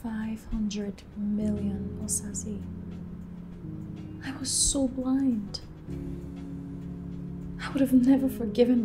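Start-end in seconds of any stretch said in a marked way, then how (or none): 0.0-5.6 slow, very soft, with long pauses; hushed, heavy self-reproach; stunned regret. Acted. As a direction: murmur it, absorbing the loss. Stunned woman reflects on loss.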